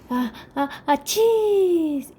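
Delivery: gran estornudo fingido que sube al final; ah, ah, y un achís agudo y fuerte. Acted. El oso estornuda. (el oso estornuda con fuerza)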